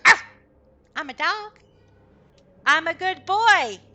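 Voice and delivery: an excited dog bragging; eager dog. Acted. The good boy answers Kai, loud eager puppy voice.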